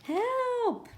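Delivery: put-on distant child's cry for help. A narrator's reading. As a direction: call out desperately from far away.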